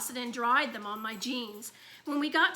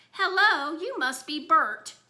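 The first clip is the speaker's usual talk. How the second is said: higher, louder puppet-style greeting; Sesame Street voice; 4 semitones higher, 7 dB louder, slower than usual. A narrator's reading. Toddler at the sink greeting his brother, cheery puppet voice. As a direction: play a cheery TV puppet, slowing on the name. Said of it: "in his best Sesame Street voice".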